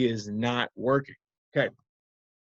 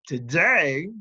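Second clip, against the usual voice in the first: ordinary delivery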